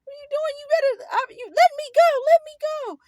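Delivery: very high, loud panicked protest; scared, crying plea. Retold or imitated. Scrawny teen being dragged to back room, scared high-pitched cries. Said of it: "I was scared and crying".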